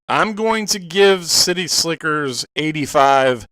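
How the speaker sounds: usual speaking voice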